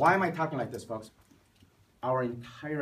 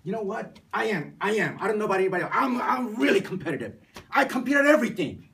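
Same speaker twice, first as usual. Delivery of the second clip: raised, higher-pitched voice of an insistent student; worked-up student; 5 semitones higher, 6 dB louder than usual. Retold or imitated. Student interrupts the professor to boast, worked-up and loud. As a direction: blurt it out loud and high, insisting. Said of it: "he just finally got up and he says".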